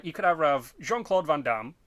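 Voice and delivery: normal talking voice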